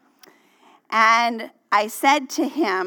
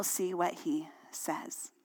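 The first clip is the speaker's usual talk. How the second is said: hushed whisper to close the promise; 6 semitones lower, 13 dB quieter than usual. Real, not acted. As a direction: let it drop to a breath. Sermon intimacy, trailing to whisper.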